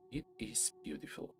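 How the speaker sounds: soft unvoiced awe, almost a whisper; awed hush. A narrator's reading. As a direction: breathe it out in wonder. Narrator lingers on the vision.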